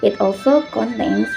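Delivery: ordinary delivery, nothing marked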